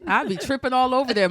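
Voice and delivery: ordinary talk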